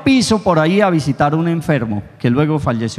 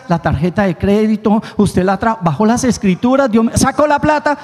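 mock worried owner; 6 semitones higher than usual. Mocking people worried about money.

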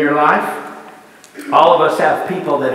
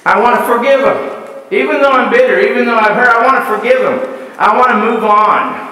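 higher, louder pleading declaration; Joseph's outburst; 4 semitones higher, 3 dB louder, faster than usual. Retold or imitated. Joseph crying out before his brothers, loud and pleading. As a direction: let it burst out, desperate to forgive. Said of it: "he was forced to say".

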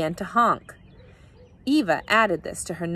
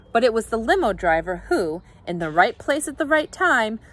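plain reading voice

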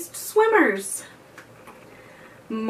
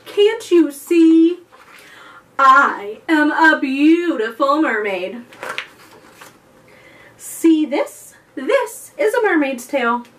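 proud mermaid; 4 semitones higher, 9 dB louder than usual. Acted. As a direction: show off like a vain beauty. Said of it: "the dugong said".

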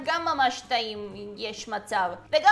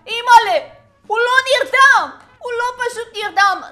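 high, loud shocked outcry; mock alarm; 7 semitones higher, 8 dB louder than usual. Host finding a game character isn't just asleep, loud mock alarm. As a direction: cry out in playful horror at the discovery.

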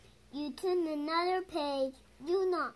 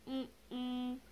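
plain reading voice

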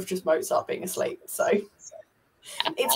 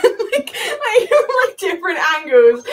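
high laughing words; high giggling speech; 10 semitones higher, 9 dB louder, slower than usual. Real, not acted. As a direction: talk through the laughter. Laughing at sister cat.

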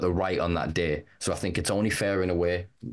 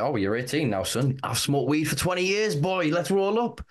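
easygoing weed-smoking mum; 8 semitones higher than usual. Acted. Mum confessing to grown son she smokes weed, increasingly high-pitched. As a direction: voice climbing higher line by line.

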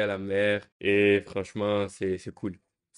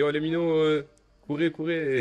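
higher calling voice, urging "courez, courez"; calling kids; 8 semitones higher than usual. Retold or imitated. Coach calling out to the little kids to run, higher pitch. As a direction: call out to the little ones to run. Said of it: "Vu que je suis coach".

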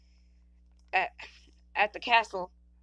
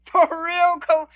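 sudden shout; 8 semitones higher, 11 dB louder, much slower than usual. Real, not acted. Sudden loud outburst over music.

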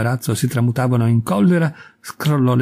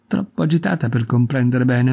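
usual speaking voice

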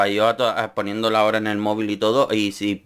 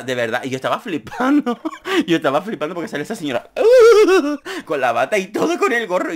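normal talking voice

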